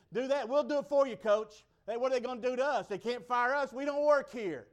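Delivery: loud, high show of support; rally behind the coach at full voice; acted crowd standing up for someone; defiant rallying.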